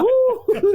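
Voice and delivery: very high, loud "woo"; imitated whoop. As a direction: let out a high-pitched mock whoop. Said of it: "He's going".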